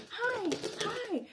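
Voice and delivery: high, bubbly fake-friendly greeting; mocking a peppy coworker's hello; chirpy coworker. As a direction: squeal it like the overly cheerful office type. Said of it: "you have to be".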